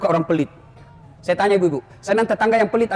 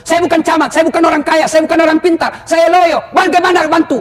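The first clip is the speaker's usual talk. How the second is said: high, loud whining excuses; whiny excuse-maker; 7 semitones higher, 7 dB louder than usual. Retold or imitated. A reluctant congregant pleading to the preacher, high loud whining. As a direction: plead helplessness like a lazy congregant. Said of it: "excuses of reluctant people".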